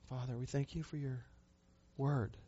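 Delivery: soft, earnest prayer voice; hushed pastoral prayer; closing prayer. Real, not acted. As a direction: pray quietly for the congregation.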